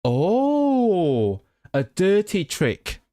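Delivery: long, high drawn-out oh, then slow; dawning realisation. Real, not acted. Learner grasps what the Welsh phrase means, surprised realisation. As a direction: let the penny drop, high and stretched out. Suddenly gets the phrase's meaning.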